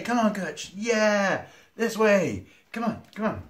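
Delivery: high, loud, excited call to a pet; calling a dog. Real, not acted. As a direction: call the dog over to play. Calling his dog over to play.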